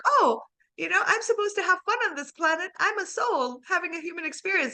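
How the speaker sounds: high, bright, carefree newcomer to earth; mock cheerful soul. Coach voices a newborn soul arriving, high and carefree. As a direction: sound naive and delighted. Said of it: "we're like".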